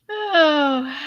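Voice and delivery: breathless laugh-cry; crying from laughing too hard; teary giggling gasp. Real, not acted. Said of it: "I wanna laugh so hard that I'm starting to cry".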